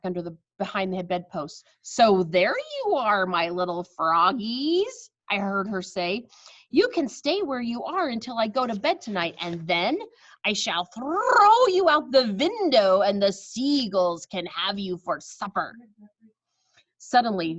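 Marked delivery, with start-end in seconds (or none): 1.8-5.1 slow, louder gloating witch voice; a sly witch gloating over caught frogs. Acted. 6.7-15.7 witch threatening the frogs with the seagulls. Acted.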